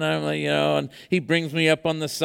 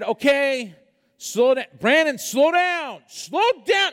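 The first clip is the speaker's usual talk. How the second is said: rising panicked yell of slow down, climbing higher; panicked plea; 8 semitones higher, slower than usual. Retold or imitated. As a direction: beg him to slow down, getting more scared each time.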